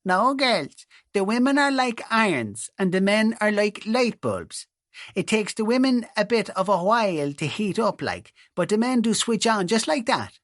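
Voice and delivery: teenage daughter mocking her priest in a put-on rural Irish accent. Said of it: "jabber in a mock rural accent".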